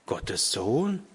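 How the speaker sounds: softly puzzled question, rising at the end; taken aback, ask it quietly; hushed, baffled echo. A narrator's reading. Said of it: "fragte der alte Mann verdutzt".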